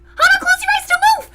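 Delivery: loud, high excited order; urgent shout. Acted. Roommate springs a surprise.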